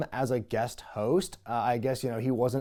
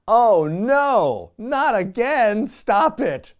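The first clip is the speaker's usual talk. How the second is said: exasperated groan; 8 semitones higher, 7 dB louder, much slower than usual. Real, not acted. Groans at the rematch announcement.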